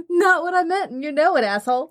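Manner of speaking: laughing. Real, not acted. Playful mock indignation after laugh.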